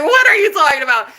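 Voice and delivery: high, loud incredulous retort; outraged disbelief. Retold or imitated. Woman answering "too skinny" remark, outraged disbelief. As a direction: exclaim it in mock outrage. Acted outraged reply to his insult.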